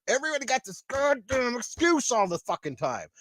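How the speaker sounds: ordinary talk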